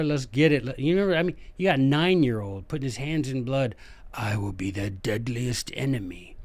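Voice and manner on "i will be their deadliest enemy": lightly voiced vow, lower in pitch; a hint of a grave sworn pledge; solemn oath. Hannibal vowing to be Rome's enemy, mock-solemn.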